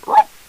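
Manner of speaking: loud ruff barked on the in-breath; inhaled dog bark. Shown as a demonstration. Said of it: "by inhaling".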